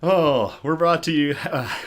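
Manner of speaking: amused jab at his own budget, chuckling mid-line; laughing through it. Real, not acted. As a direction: laugh out the words. Joking about budget, laugh heard.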